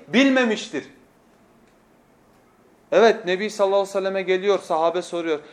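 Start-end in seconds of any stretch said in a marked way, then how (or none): none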